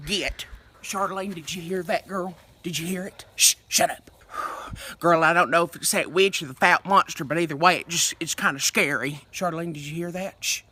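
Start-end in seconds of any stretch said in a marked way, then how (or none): 0.8-4.1 scared hush. Acted. Hunting for witches at night. 9.3-10.6 scared hush. Acted. Hunting for witches at night.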